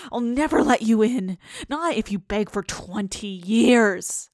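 loud, defiant refusal; angry shout. Acted. Dreamer yelling at the begging ghost, loud and defiant. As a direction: refuse the ghost with all your strength. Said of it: "I shouted".

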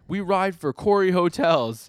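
loud, high, drawn-out battle call; rally the troops, hammed up; mock rallying cry. Retelling Oboro rallying his boys, mock war cry.

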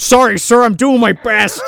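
hammed-up servile trooper; mock henchman. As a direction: play a dim soldier apologizing to his boss. Mocking a henchman.

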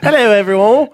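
high-pitched mock English accent. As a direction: greet them in a hammed-up English accent. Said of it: "I'd like to do English with it".

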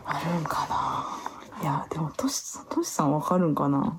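slow whisper, wondering half to herself; hushed musing about Toshi; whispered aside. Real, not acted. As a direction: breathe it out as a private thought.

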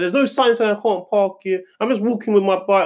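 high mocking voice of the customer; mock screeching. Guard imitates the customer's protest in a high mocking voice. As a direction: squeal her complaint back mockingly. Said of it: "screeching, saying".

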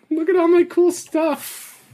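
high-pitched, drawn-out mocking voice; mock a show-off friend in a silly high voice; slow, squeaky-high braggart; Tim Moore impression. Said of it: "That's an actual impression".

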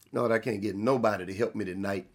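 low, soft, quick aside; muttered aside. Real, not acted. Preacher grumbling to the Lord about getting no help tonight, muttered aside. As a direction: drop it low and quick, under the sermon. Aside complaining of no congregational response.

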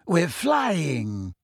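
maddeningly serene, drawn-out words; airy character voice, unruffled; Luna. A narrator's reading. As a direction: stretch it dreamily, quite unbothered. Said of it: "said Luna maddeningly".

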